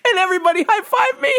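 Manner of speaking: fast, high, triumphant yell; excited shout. Real, not acted. Bragging to the audience about the high-fives, shouting.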